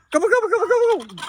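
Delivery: high, fast gobble-gobble to the birds; turkey gobble call. Acted. Farmer calling his turkeys in a high turkey gobble.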